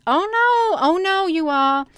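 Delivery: sudden high, loud cry of alarm. Real, not acted. Reacting to the page for viewers, shocked and loud. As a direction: gasp it out, genuinely shocked.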